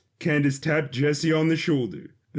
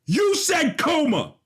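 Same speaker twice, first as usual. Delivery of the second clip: furious man shouting down his ex; angry shout; 13 semitones higher than usual. Acted. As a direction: yell it at her.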